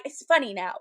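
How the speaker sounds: ordinary talk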